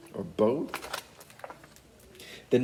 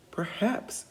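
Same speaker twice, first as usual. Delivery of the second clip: sly perhaps; 11 semitones higher, 3 dB quieter than usual. A narrator's reading. Finn's sly reply.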